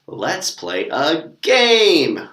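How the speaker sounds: very slow, drawn-out "Let's play a game"; teacher modelling for learners; slow demo. Shown as a demonstration. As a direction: stretch every word of "Let's play a game". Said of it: "So I'll just go".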